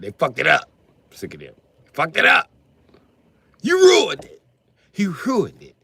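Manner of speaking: rising furious yell at the developers; angry shout. Real, not acted. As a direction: blow up at the game makers. Ranting at game makers.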